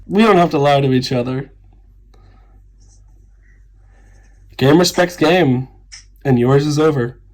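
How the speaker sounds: smug challenge to a rival player; cold confrontation. Acted.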